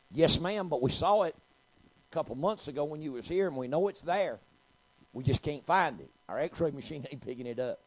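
loud, high-pitched justification; insistent defense. Retold or imitated. Doctor explaining missing cancer to patient, loud and insistent. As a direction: plead the case forcefully as the doctor.

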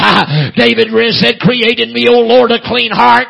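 building from loud cadence to high shouting; full-force preaching. Real, not acted. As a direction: whoop it out with a gasped "hah" after phrases.

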